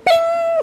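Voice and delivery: very high bright ping sound effect; a sparkling high ping; magic ping. Acted. Sorceress appearing with a high magic ping.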